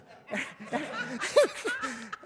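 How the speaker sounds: bursting high laugh; laughing at the memory of following her; real laughter. Real, not acted. A preacher laughing at his own story, real laugh.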